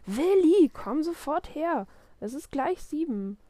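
high, loud shout, then insistent; very cross father. A narrator's reading. Papa in the kitchen at almost seven, very cross. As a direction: order the child here at once. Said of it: "Papa da draußen in der Küche mächtig böse".

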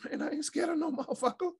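high, defiant retort. Retold or imitated. Player telling coach he's not scared, high defiant. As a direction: fire back, voice jumped up. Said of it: "I said".

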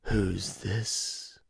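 slow, raspy whispering killer twins trading lines; twins voices. Retold or imitated. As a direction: hush it like patient, hungry killers plotting. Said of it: "I'm trying to do their voices".

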